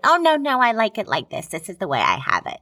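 Tammy Faye voice. Retold or imitated. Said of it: "she's like".

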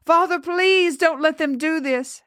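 high, desperate appeal; begging. A narrator's reading. Girl appealing to her father, high begging. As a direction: beg for rescue in a high voice. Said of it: "her eyes begging".

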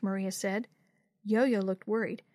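ordinary delivery

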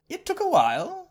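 put-on boy proud of his escape; grinning boy. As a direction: let the grin show in it. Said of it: "Zarek grinned".

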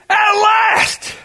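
high, loud cry of relief; joyful cry. Retold or imitated. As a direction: cry out At last! overjoyed. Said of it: "He goes".

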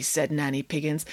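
ordinary delivery, nothing marked

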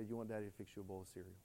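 put-on soft, fast, gentle offer to a child; tender father. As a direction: coax him softly like a loving dad. Father comforting son, retold.

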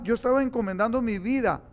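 ordinary talk, nothing marked

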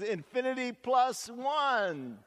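put-on child voice, pleased with herself; little girl voice. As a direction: top the last answer like a clever child. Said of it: "another little girl says".

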